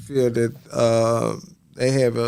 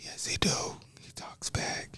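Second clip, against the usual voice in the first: whisper; 18 dB quieter than usual. Real, not acted. Whispered reply.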